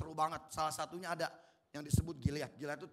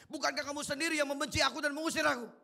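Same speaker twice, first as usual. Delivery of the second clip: higher and louder, angry accusing demo; angry reading; 8 semitones higher, 5 dB louder than usual. Shown as a demonstration. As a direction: fire the line back in anger. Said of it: "Dan saya ulang itu".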